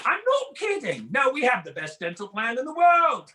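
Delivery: high-pitched mock British accent. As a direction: insist like a hammed-up Brit. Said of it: "their accent makes them sound like they know everything".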